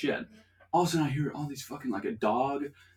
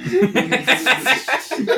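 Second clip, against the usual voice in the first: high burst of laughter; loud laugh; 13 semitones higher, 10 dB louder than usual. Real, not acted. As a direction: crack up loudly. Big laugh mid story.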